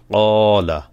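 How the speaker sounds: first vowel of "কলা" held long, "লা" short; one-alif stretch. Shown as a demonstration. Teacher reading the madd words for learners, long-held "ক" in "কলা". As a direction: draw out the "ক" in "কলা". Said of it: "কফ এক আলিফ টান".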